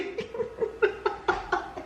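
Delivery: high unvoiced giggle; breathy high giggle. Real, not acted. Vlogger giggling after his sister's insult. Laughing at the tease.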